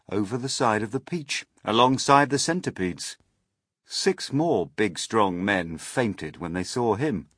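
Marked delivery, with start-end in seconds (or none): none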